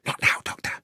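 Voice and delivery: whispered brush-off; urgent whisper; hushed warning. Acted. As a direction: whisper it to silence them. Policeman hushes the doctor while hiding.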